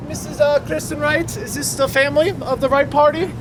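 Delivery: a lightly put-on Italian accent, playing the maître d'. Greeting the Wright party at Palo in a light mock Italian accent. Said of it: "Where did the Italian accent go?".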